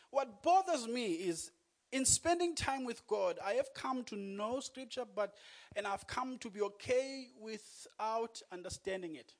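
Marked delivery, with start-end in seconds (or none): none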